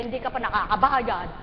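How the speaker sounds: ordinary delivery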